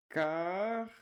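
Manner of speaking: "carro" with a long rising "ca" and a stretched rasped "rr"; drawn-out demo. Shown as a demonstration. Teacher contrasting "carro" with "rato", sounds drawn out. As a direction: hold both "ca" and "rr" in "carro". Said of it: "não é rato".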